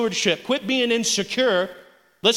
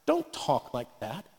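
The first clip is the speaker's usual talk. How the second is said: hushed, low, gentle inner voice; soft, low murmured correction; still small voice; 7 semitones lower, 10 dB quieter than usual. Acted. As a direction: speak very softly, a quiet check from inside. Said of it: "that still small voice say".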